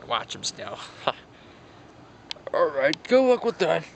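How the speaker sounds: ordinary talk, nothing marked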